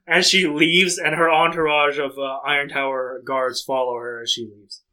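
ordinary delivery

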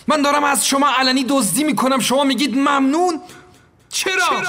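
outraged employer shouting; angry outburst. Acted. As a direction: rail at her in disbelief. Narrator furious at the maid.

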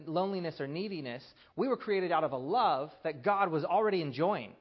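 preaching. Acted. Preacher driving home the point to the congregation, full force. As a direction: preach it out, building conviction.

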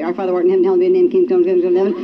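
rushed, slurred mumble of the prayer; rattled-off prayer. Shown as a demonstration. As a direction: rattle it off without thinking. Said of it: "But I usually say it this way".

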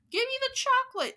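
high, loud demand; shouting. A narrator's reading. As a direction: shout it like a suspicious boy. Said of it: "shouted Bruno".

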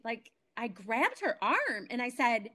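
usual speaking voice

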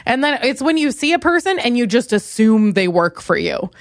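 normal talking voice

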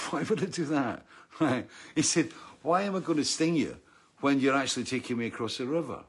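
acted-out incredulous protest; indignant protest. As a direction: argue your innocence as the storybook scorpion. Scorpion protests to the turtle.

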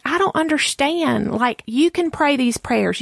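high-pitched exasperation, retold; frustrated. Friend urging her to believe, frustrated. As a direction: act out a friend at her wits' end. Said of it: "she was getting kind of frustrated".

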